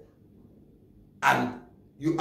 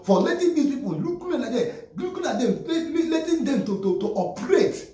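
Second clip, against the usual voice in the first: higher, with a scoffing laugh; mock the idle police in disbelief; indignant; 9 semitones higher than usual. Real, not acted. Vlogger shaming Nigerian police for inaction, scoffing.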